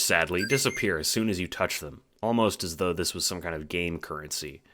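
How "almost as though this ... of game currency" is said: ordinary delivery, nothing marked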